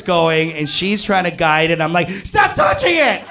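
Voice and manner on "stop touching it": very high comic shriek; panicked yell. Retold or imitated. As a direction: squeal it out in mock panic. Retold panicked outburst during first time.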